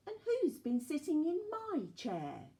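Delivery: high, light mother bear voice. A narrator's reading. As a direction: raise the pitch without roaring. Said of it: "said in a quieter voice".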